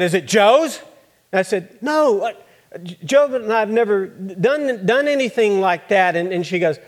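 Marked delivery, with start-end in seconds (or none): 0.0-0.8 sharp suspicious question. Acted. Mother questions pregnant teen daughter. 1.8-6.1 higher and louder, protesting hard; upset denial. Acted. Daughter denying to her suspicious mother, high and loud.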